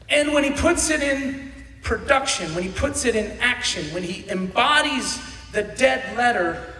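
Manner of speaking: loud, high, driving sermon voice; full force preaching. Acted. Pastor preaching to the church, loud and driving. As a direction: preach it to the congregation.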